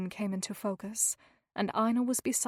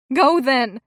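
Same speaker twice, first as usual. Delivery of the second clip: loud desperate shout; shouting to someone in the doorway; 3 semitones higher, 12 dB louder than usual. A narrator's reading. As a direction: yell it over your shoulder. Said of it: "she shouted".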